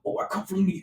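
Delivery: mock New York accent. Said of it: "they're like".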